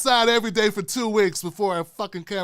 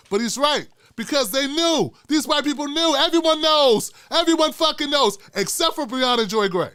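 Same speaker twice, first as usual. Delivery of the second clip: building rant; 8 semitones higher, 5 dB louder than usual. Real, not acted.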